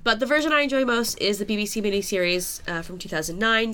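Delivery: ordinary delivery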